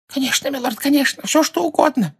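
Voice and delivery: high, nasal, begging whine; nasal pleading servant. A narrator's reading. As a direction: grovel through the nose. Servant eagerly agrees. (гнусавый умоляющий голос)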